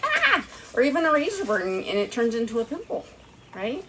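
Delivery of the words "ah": pained yelp. Retold or imitated. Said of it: "and you're like".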